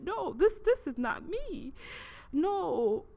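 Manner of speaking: high, swooping cry of denial; distressed denial. Retold or imitated. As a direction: refuse it in rising distress. Denying her own reflection.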